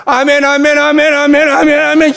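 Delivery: high, loud ringing amen chant; congregation's fervent amens. Retold or imitated. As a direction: imitate a congregation shouting amen. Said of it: "이래요".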